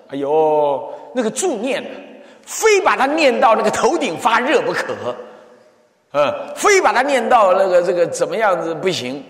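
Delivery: lightly hammed-up fervent believer; mock zealous insistence. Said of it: "很多人都这样想". (模仿执着信众的语气)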